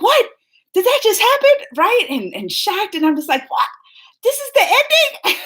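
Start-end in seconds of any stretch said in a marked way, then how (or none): 0.0-1.7 much higher, loud disbelief; shocked. Retold or imitated. Shocked at a twist ending. 3.5-5.5 much higher, laughing disbelief; shocked laughing. Retold or imitated.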